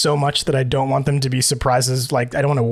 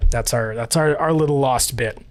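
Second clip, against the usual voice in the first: ordinary talk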